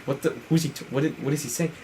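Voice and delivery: very soft, halting mock murmur; imitated quiet bewilderment; hushed confusion. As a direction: murmur it as puzzled onlookers. Retold crew puzzled by his muttering.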